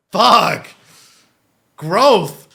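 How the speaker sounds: overwhelmed by the moment; real loud outburst. Real, not acted. Streamer moved by the hug scene, loud outburst. Moved by father hugging son.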